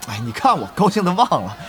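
normal talking voice

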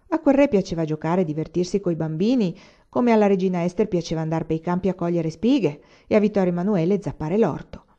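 usual speaking voice

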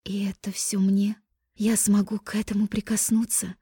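breathless admiring whisper; awed whisper. Acted. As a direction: whisper it in awe. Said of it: "восхищённым плачем спросить".

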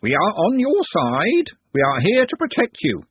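high-pitched, stretched squawk; squawking bird creature. A narrator's reading. Bird creature offering protection to Glenda, squawking. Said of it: "squawked one of them".

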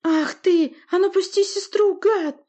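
high, loud furious yell; angry shout. A narrator's reading. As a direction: yell at him to let go. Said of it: "воскликнул Олаф".

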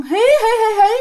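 high-pitched eerie "hee hee" imitation; mimicked high witchy giggle; ghostly tittering laugh. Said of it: "Mà bà cười nhiều điệu lắm".

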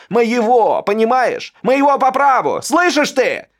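high, loud, shrill-edged tirade against his brother; rant, near tears, self-pitying fury; ruined heir screaming at his older brother; hysterical brother. A narrator's reading. Alexander's hysterical accusing rant.

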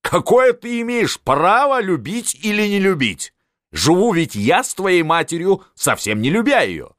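high, forceful fatherly rebuke; indignant scolding. A narrator's reading. Father scolds his pleading son. (возмущённая отповедь на высоких тонах)

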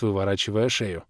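ordinary delivery

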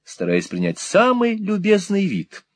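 ordinary delivery, nothing marked

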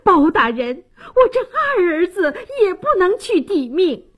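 high, desperate mother's wail; acted frantic, tearful protest; crying out. A narrator's reading. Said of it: "王大妈又喊起来".

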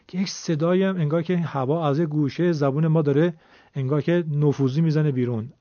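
ordinary delivery

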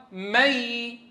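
"مي" with the noon turned into a long nasal-held y; merged noon. Shown as a demonstration. Teacher shows students what the merged noon became, held ghunna. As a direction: draw out "مي", humming the y through the nose. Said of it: "تعالوا نشوف لما أدغمناها شو صار".

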